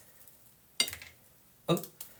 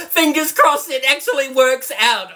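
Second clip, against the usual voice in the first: laughing; 7 semitones higher than usual. Real, not acted. Laughing nervously at first try.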